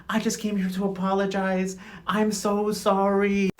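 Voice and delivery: drawn-out, softly sung-out insincere sorry; mock a phony apology, slow and soft; syrupy fake remorse, stretched out; extra fake apology. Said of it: "Hosiane was extra fake".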